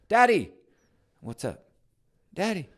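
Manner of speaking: put-on high, loud child's call; a kid impatient for dad. Child voice vs parent.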